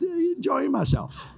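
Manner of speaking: a short laugh through the words, voice jumping up. Real, not acted. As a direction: laugh it off, amused at yourself. Preacher laughs at running over.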